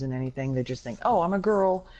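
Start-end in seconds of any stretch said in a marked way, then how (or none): 1.0-1.9 higher, louder mimicry of a naive girl; mock naive girl. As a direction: imitate a clueless "oh!" realization. Mimicking naive self-declared feminists.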